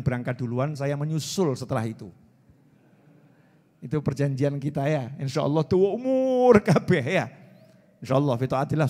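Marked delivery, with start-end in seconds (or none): none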